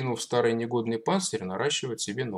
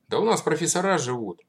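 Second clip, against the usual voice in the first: boastful; 6 semitones higher, 6 dB louder than usual. A narrator's reading. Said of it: "похвастался куриная слепота".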